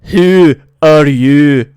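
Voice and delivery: put-on very loud, slow shout in a thick Russian German accent. Blue-skinned stranger challenges the party, slow booming accented shout. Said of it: "shouts out".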